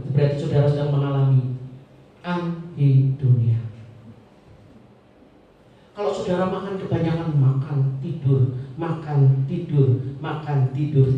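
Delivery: normal talking voice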